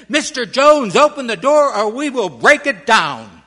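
loud, high commanding shout; police shout. A narrator's reading. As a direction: bark it through the door like police. Police pound at the door.

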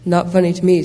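loud, mildly cross retort; indignant snort. A narrator's reading. Giraffe to the amused elephant, a loud indignant snort. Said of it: "snorted the giraffe".

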